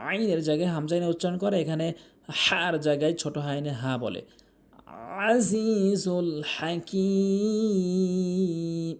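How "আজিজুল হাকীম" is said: আজিজুল with ain, হাকীম with heavy ha, very slow; slowly drawn আজিজুল হাকীম, proper throat letters; correct recitation. Shown as a demonstration. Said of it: "এভাবে উচ্চারণ করতে হবে".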